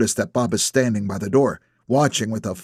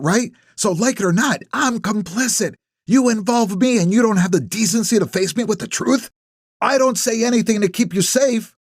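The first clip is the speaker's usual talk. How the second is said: Ellie voiced higher, indignant and pressing; angry accusation; 9 semitones higher than usual. A narrator's reading. Ellie confronts Nap in the driveway, higher, indignant and pressing. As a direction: push him hard on the line he crossed.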